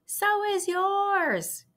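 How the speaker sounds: very high, slow teasing cry. Acted. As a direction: drag it out to tease her. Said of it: "cried Avery".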